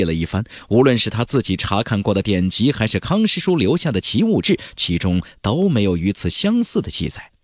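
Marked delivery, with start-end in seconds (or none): none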